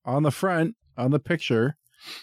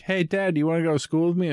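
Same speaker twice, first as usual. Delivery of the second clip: put-on much higher, eager kid voice; mock kid asking; 7 semitones higher, faster than usual. Kids inviting their tiny dad to school, in a high eager voice. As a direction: chirp it like an excited little kid. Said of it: "They're like".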